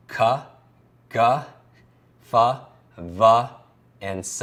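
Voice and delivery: "T, T, th" as separate sounds plus short uh; letter sounds. Shown as a demonstration. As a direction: sound out "T, T, th" one by one as tuh, tuh, thuh, with pauses. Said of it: "give you the sounds for these digits".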